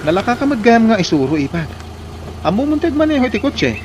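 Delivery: high, loud cheering; excited praise. Acted. Brother-in-law excitedly praising the new driver. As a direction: cheer the learner on.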